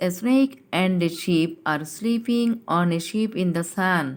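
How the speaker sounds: slow classroom sentence; slow read-aloud. Shown as a demonstration. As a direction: read it out slowly so kids follow. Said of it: "কি বলেছে আমাদের sentence".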